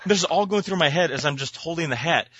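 ordinary delivery

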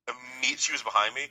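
ordinary delivery

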